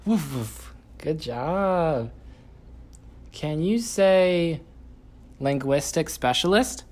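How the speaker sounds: put-on high, sing-song voice for a small child; reading to a toddler. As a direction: coax a toddler, bright and playful.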